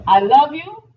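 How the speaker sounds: "I love you" loud, rising like a question; questioning inflection. Shown as a demonstration. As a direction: let "you" climb high, loudly. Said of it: "you change the inflection".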